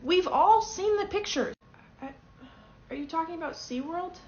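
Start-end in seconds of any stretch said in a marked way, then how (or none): 0.0-1.5 high, loud and slow, full of accusation; accusing shout. Acted. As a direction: nail the guide with the proof. Tourist accuses the guide.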